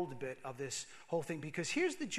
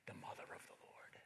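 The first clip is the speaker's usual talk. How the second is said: barely breathed, full of wonder; hushed reverent whisper; awed whisper; 17 dB quieter than usual. Acted. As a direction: whisper it as if afraid to break the moment. Mary's realization, voiced inwardly.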